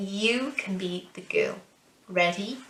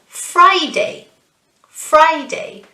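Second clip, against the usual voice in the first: "Friday" with FRI high and loud; first-syllable stress; 7 semitones higher, 6 dB louder, slower than usual. Shown as a demonstration. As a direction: punch FRI in "Friday", high, then fall. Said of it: "right at the start again".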